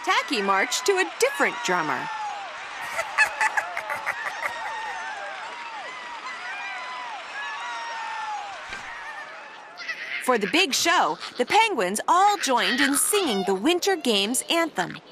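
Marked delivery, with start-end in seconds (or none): 2.0-9.2 very high, soft long giggle. Acted. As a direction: laugh at the silly march. Laugh after Tacky's drumming.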